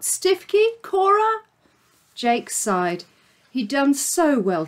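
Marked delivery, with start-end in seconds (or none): 0.0-1.4 high calls into empty chambers; calling out names. Acted. Jake calling for his missing friends, high searching call. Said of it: "Jake called out".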